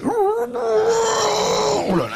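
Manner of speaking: very high, slow drawn-out wail; dismayed. Acted. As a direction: stretch the dismay out. Mother sees grandfather.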